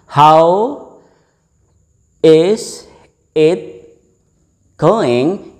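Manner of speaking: "How" "is" "it" "going" split apart with pauses; word by word. Shown as a demonstration. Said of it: "It will be pronounced". (ucapkan "How is it going" kata per kata dengan jeda)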